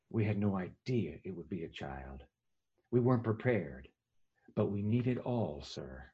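low, soft heavy voice; doctor to the father, low and grave. Retold or imitated. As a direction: deliver terrible news gently. Doctor delivers grave news.

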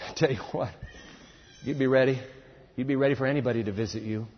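normal talking voice

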